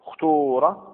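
اختور with a long pure "oo": ukhtuura; pure damma. Shown as a demonstration. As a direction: say اختور with full rounded "oo". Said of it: "وإخلاص الضم". (اختور بإخلاص الضم)

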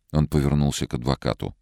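ordinary delivery, nothing marked

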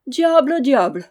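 loud, high, through laughter; laughing oath. Acted. Knight laughs at the boy's boast, still laughing. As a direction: let the laugh carry the oath. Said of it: "fit le chevalier, qui riait toujours".

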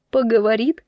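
mildly incredulous echo with a rise; first, milder startled question; doubtful question. Acted. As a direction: query it in disbelief, still contained. Repeats his words in alarm.

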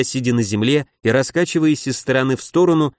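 ordinary delivery, nothing marked

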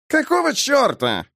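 high-pitched disgruntled objection; indignant gripe; grumbled protest. A narrator's reading. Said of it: "буркнул Бэкфилд".

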